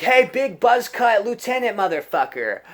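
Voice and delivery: high, loud sing-song shout; high yelled mock announcement; shrill tattling call.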